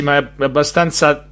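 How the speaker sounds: normal talking voice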